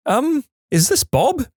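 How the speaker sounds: put-on high, slow, uncertain old woman's voice; elderly lady caller. Caller realising she has the wrong number, high, hesitant old lady.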